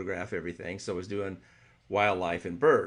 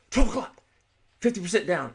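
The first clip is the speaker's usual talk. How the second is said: excited callout; 8 semitones higher, 4 dB louder than usual. Retold or imitated. Guide calling out bird position.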